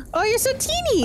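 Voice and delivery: high delighted squeal; cutesy squeal. Real, not acted. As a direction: coo at something tiny. Friend shrunk.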